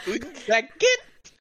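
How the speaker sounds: slow, high, loud mock French; silly French accent. Pushing the French joke too far, drawn-out laughing accent. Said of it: "why am I French now?".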